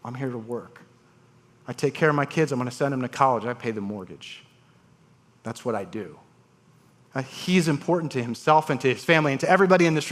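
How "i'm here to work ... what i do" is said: serious. Retold or imitated. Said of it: "with all seriousness".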